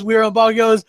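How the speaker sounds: normal talking voice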